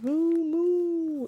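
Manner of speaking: ordinary delivery, nothing marked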